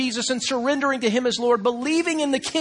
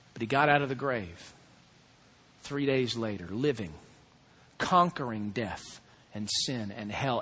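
solemn preaching; 10 semitones lower, 5 dB quieter than usual. Acted. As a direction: draw the congregation in quietly.

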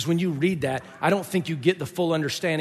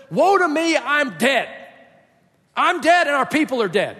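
raised, urgent lament; Isaiah crying out; 9 semitones higher, 7 dB louder than usual. Acted. Preacher as Isaiah before God, crying out in despair. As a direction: cry woe with a loud, high start. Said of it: "When he cries out".

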